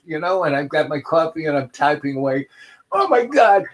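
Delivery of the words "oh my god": starstruck fan. Retold or imitated. Fan recognising him again.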